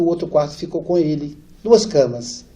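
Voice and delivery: ordinary delivery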